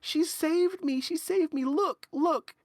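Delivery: put-on very high, loud pleading kid voice; small child interrupting. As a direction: cut in urgently, pleading with Mommy. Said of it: "you hear the small child kinda interrupt".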